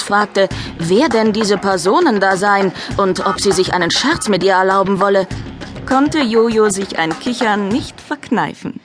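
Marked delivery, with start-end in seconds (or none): none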